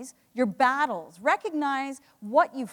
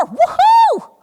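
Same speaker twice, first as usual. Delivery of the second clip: loud, high whoop of joy; excited cheer; 16 dB louder than usual. Real, not acted. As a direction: let out a big triumphant woohoo. Cheering after counting raised hands.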